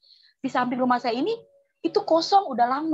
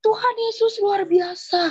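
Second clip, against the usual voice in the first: high, slow mock shout; retold cry of amazement; 9 semitones higher than usual. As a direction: imitate the neighbour crying out in awe. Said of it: "dia teriak ngelihat muka kita". (menirukan teriakan takjub, bernada tinggi)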